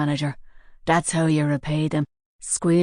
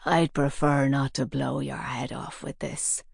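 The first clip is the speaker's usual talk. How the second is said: hoarse; 2 semitones lower, 5 dB quieter than usual. A narrator's reading. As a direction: rasp the threat quietly. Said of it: "he said hoarsely".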